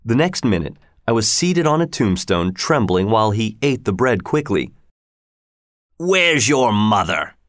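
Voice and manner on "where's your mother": slow, rough question; convict. Acted. Convict questioning Pip in the churchyard, slow and gruff. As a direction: press the boy, unhurried and menacing.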